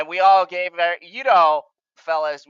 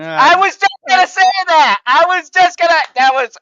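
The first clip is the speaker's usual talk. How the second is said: loud, much higher exclamation; excited outburst; 13 semitones higher, 11 dB louder than usual. Real, not acted. Host reacting to co-host's impression, loud excited outburst. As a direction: burst in, thrilled to be beaten to it. Thrilled the impression was done.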